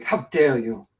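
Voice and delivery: lightly voiced indignant girl, barely shifted; a hint of mock outrage at the end; imitating Greta. Mocking the climate girl for his co-host, lightly indignant. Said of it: "what was that little girl that was".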